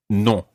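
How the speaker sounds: "Non" modelled with a nasal o; nasal French vowel. Shown as a demonstration. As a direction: let "Non" resonate in the nose, no hard final n. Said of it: "get that nasal sound".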